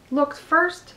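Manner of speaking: ordinary delivery, nothing marked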